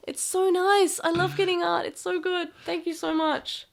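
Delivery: high, bright gushing over a gift; delighted thanks to the artist. Real, not acted. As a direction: gush with delight at the fan art. Gushing over fan art.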